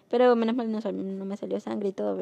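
ordinary talk, nothing marked

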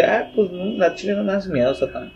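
half-hearted defiance, not scared; unbothered reply. Retold or imitated. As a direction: brush off Satan, lightly mocking. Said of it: "Y tú así como que".

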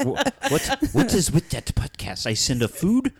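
laughing, high Caribbean accent impression. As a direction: put on a playful Caribbean accent. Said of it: "That's my Caribbean accent".

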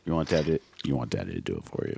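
deep, soft mocking daddy tone; low teasing daddy voice. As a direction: tease him in a low croon.

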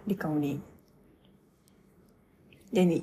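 ordinary talk

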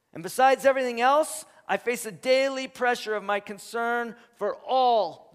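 loud, high, relentless list of hardships; preaching at full force. Acted. Preacher reading Paul's sufferings to the congregation, loud and high.

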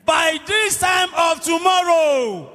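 high, slow prophetic proclamation; confident declaration. Retold or imitated. Preacher quoting Elisha's promise in faith, confident declaration. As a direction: proclaim it with faith, drawing out TOMORROW. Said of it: "with confidence and faith in God".

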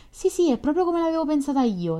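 high, delighted burst; joyful exclamation. A narrator's reading. Nastenka hears her own letter put into words, joyfully exclaiming. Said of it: "esclamò Nastenka, i suoi occhi brillarono di gioia".

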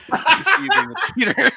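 giggling out the line at a friend; laughing. Real, not acted. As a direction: tease him through a laugh. Laughing while teasing friend.

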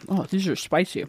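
ordinary delivery

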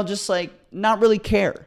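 ordinary talk

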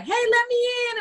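high, pleading call into a call box; calling to be let in. Retold or imitated. Susie at the call box in the rain, calling high.

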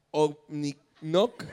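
very slow, puzzled sounding-out; acted slow decoding of a strange word; halting reading. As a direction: read it syllable by syllable as if baffled. Acts reading reversed words slowly.

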